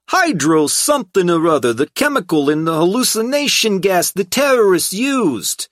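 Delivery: high, loud brash thug; relieved brash hoodlum voice. A narrator's reading. Tripp character voice continues.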